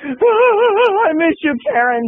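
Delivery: high, slow, drawn-out call; high, slow mock-longing call to an absent friend. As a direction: croon it high and slow like you miss her.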